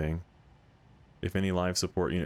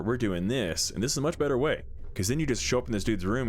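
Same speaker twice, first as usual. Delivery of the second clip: normal talking voice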